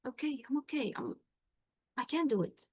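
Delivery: quiet nervous self-talk; unsure self-talk. Shown as a demonstration. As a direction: mutter it as if steadying your own nerves. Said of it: "If you do this, you will find yourself".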